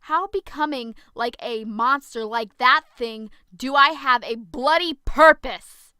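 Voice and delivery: very loud, anguished angry shout; furious yell. Acted. Zoe yelling at Penelope about becoming a monster, furious shout. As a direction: hurl the question at her in rage. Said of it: "I yelled".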